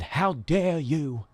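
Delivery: slow, dragged-out imitation of Greta's outraged line; lightly voiced mock of the climate speech; Greta impression. Host imitating Greta Thunberg's famous rebuke, slow. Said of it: "when she said".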